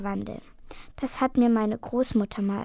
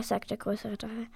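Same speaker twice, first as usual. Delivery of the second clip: ordinary delivery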